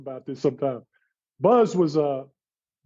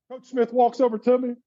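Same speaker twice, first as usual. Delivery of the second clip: usual speaking voice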